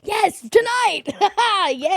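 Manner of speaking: high, loud, drawn-out cheer; excited. Real, not acted. Comic thrilled her show is tonight, excited. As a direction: sing it out with glee.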